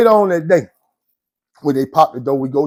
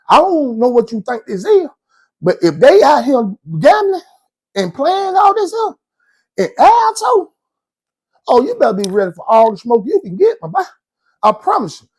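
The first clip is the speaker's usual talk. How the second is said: heated warning; 7 semitones higher than usual. Retold or imitated.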